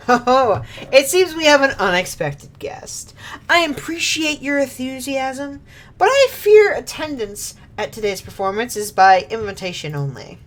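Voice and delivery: courteous, theatrical warning to an intruder; polite villain voice. Acted. Voices a character in scene.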